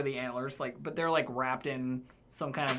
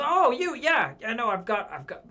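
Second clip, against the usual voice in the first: excited half-orc barkeep; 9 semitones higher, 7 dB louder than usual. Acted. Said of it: "he goes".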